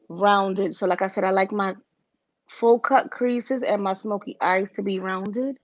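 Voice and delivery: ordinary talk, nothing marked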